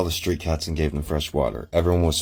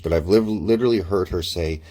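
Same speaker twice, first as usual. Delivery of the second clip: ordinary talk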